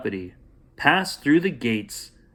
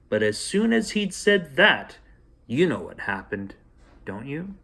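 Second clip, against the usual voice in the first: ordinary delivery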